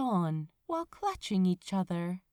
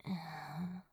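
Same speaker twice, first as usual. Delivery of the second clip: sigh; 3 semitones lower, 10 dB quieter than usual. Acted. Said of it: "He sighed".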